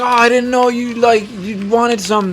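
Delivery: high, slowing mock-innocent voice; sheepish new girl. New girl excusing herself to the narrator, high and innocent. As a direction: play the coworker caught out, high and drawn out.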